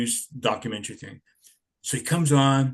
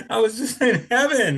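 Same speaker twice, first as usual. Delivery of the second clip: laughing delight; 10 semitones higher, 4 dB louder than usual. Real, not acted. Laughing joy at the memory.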